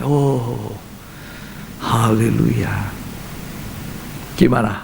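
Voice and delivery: normal talking voice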